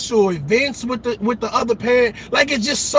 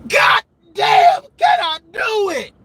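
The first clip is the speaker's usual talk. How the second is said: exasperated shout; 10 semitones higher, slower than usual. Real, not acted. Ranting to viewers, a high exasperated shout. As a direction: throw up your hands and yell it. Frustrated outburst.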